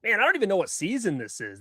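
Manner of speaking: usual speaking voice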